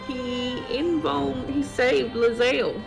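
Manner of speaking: ordinary talk, nothing marked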